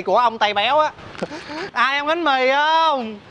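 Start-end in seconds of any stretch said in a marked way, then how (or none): 1.7-3.2 high, very slow hawker call; vietnamese street cry. Acted. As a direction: sing it out to passersby. Calling customers in the street.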